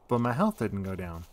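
ordinary delivery

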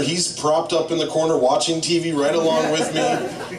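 usual speaking voice

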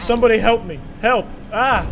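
loud, high plea for help; mock cry for help. Trapped character calling out for help. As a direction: yell for help like a trapped critter. Streamer voicing trapped creature.

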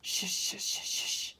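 whispered engine shushing; whispered sad steam shush; mournful quiet shush. Acted. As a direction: breathe it out sadly, barely there. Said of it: "mournful, quiet".

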